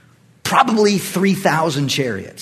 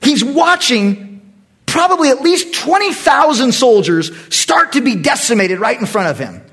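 ordinary talk

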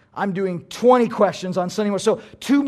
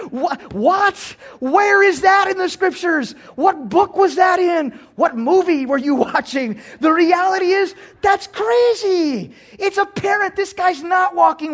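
loud and higher, disbelieving yells; incredulous shout; 9 semitones higher, 4 dB louder than usual. Real, not acted. Pastor mocking a claim that the thief fell away, incredulous shout. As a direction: throw the question back in outrage. Preacher mocks an absurd claim.

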